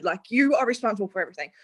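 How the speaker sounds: normal talking voice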